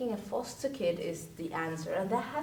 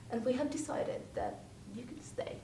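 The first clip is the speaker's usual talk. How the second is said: Marilla voice; 3 semitones higher, 9 dB quieter than usual. Retold or imitated. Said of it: "this is my Marilla voice".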